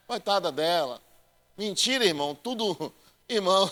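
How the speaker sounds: ordinary talk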